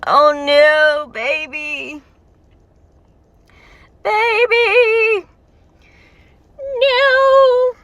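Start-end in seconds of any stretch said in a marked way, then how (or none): 4.0-7.7 high, loud wail of no; distressed fan shouting at the screen; anguished cry. Real, not acted. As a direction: cry out in protective despair. Anguish over the character's fate.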